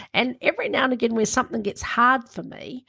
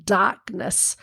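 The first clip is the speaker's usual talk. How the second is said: ordinary delivery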